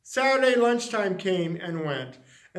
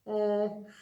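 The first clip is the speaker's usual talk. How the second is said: sobbing; 5 dB quieter, slower than usual. Acted. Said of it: "she sobbed".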